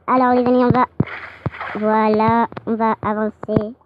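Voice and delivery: ordinary delivery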